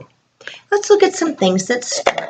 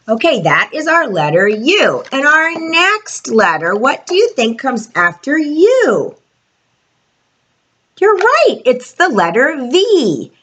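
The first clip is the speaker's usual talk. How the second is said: put-on broad singsong kids theatre; 5 semitones higher than usual. Teacher addressing young children.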